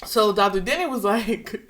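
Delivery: usual speaking voice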